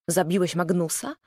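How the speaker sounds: ordinary delivery, nothing marked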